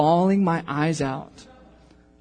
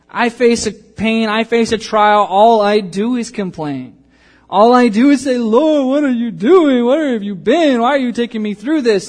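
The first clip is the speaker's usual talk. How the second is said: impassioned complaint; 7 semitones higher, 9 dB louder than usual. Acted. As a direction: plead to the Lord at full force. Preacher complaining to God loudly.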